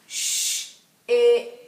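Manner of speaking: Sh-ip split loud and robotic; robot sounding out. Shown as a demonstration. Teacher sounding out ship robot-style for children. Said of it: "say it slowly like a robot".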